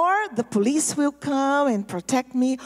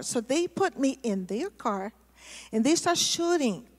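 ordinary talk, nothing marked

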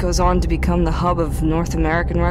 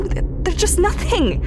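"nothing" falling away, voice pitched higher and breaking; higher, stumbling despair; 12 semitones higher than usual. Acted. Lonely scout confessing to her log, pitched-up despair. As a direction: let it crack up high on "nothing". Despair over empty wasteland.

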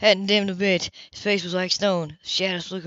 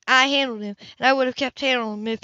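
higher, louder furious woman; 5 semitones higher, 5 dB louder than usual. Acted. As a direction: insist hotly, cut off. Grace furious.

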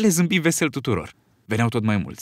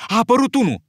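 excited shout; 10 semitones higher, 4 dB louder than usual. Acted. Piggy spots a boy.

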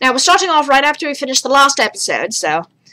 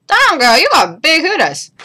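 ordinary delivery

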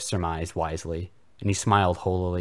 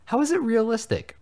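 incredulous; 4 dB louder than usual. Acted. Voldemort incredulous.

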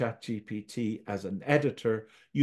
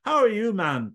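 higher, louder friendly exclamation; exclaimed greeting; 7 semitones higher, 5 dB louder than usual. A narrator's reading. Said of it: "Tom exclaimed".

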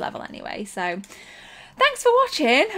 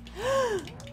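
sharp, high intake of breath; real high gasp; startled gasp; 12 semitones higher, 4 dB quieter than usual. Real, not acted. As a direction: gasp in sudden shock. Startled in game.

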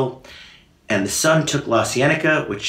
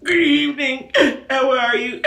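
much higher, put-on crooning greeting; school kid's voice. Imitating a schoolmate's voice, high and crooning. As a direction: sing the greeting up high like that kid. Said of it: "a kid we went to school with who talked like".

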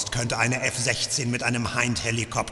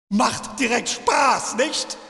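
taunting shout; 10 semitones higher, slower than usual. Acted. Torturer taunting his captive.

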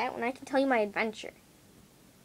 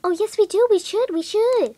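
higher, louder coaxing friend; child imitates friend urging, higher and louder; pushy friend; 9 semitones higher, 9 dB louder than usual. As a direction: wheedle like the friend pushing for the blacktop. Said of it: "And she's like".

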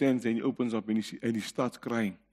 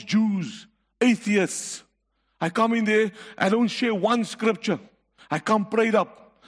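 raised, driving pulpit preaching; congregation-stirring sermon voice, raised and insistent; fiery preaching; 7 semitones higher, 4 dB louder than usual. Acted. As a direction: preach it out to the congregation, full force. Preacher building at full force.

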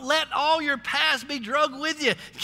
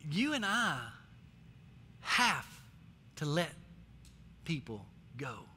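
hushed solemn; 10 semitones lower, 10 dB quieter than usual. Real, not acted. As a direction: let it fall to a hush. Soft solemn point.

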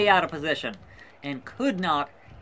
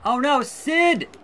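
high, slow dismayed call; alarmed cry; 7 semitones higher, 4 dB louder, slower than usual. Real, not acted. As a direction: drag it out in disbelief. Sid about to lose.

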